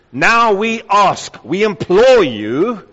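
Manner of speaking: slow, forceful stress on "Now we ask, we implore you"; emphatic plea. Shown as a demonstration. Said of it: "it's got a heightened emphasis".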